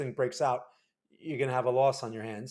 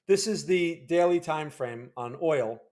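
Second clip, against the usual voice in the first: normal talking voice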